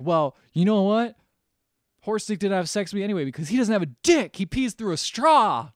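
high, loud gossipy jab; hammed-up mean girl reveal; mock catty retort. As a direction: dish the dirt with relish. Cindy's retort in retold movie.

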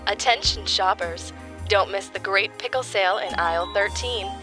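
high, loud store announcer; flat echoey pa page. Acted. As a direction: read it like a supermarket tannoy.

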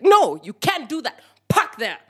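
high, loud indignant command; retold angry order. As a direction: shut him down and order him about. Orders the driver to park.

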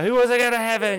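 high, loud preacher rally cry; revival hype. Retold or imitated. Preacher firing up the church, high and loud. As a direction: pump up the congregation.

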